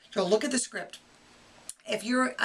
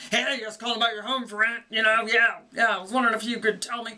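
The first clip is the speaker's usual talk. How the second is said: rough caller; 3 dB louder than usual. Retold or imitated. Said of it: "you know, that's rough".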